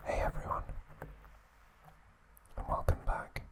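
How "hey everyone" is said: ordinary delivery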